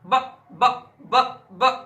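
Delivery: ब said loud with the lips closing and opening; demonstrating ba again. Shown as a demonstration. Teacher sounding out ब for students to copy. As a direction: repeat ब for students. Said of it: "उच्चारण करके देख सकते हैं".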